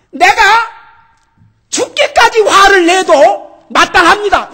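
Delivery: loud, high, slow defiant outcry; defiant angry shout. Retold or imitated. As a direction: hurl it back in God's face. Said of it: "이러고 달라들었어요".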